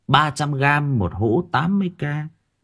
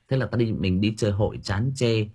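ordinary delivery

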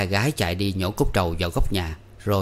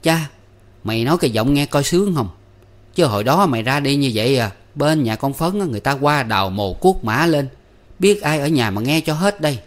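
higher, louder annoyed scolding; mother scolds her grown son, annoyed and higher; 5 semitones higher, 4 dB louder than usual. A narrator's reading. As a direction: scold the son in mild exasperation. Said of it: "bực mình với nó".

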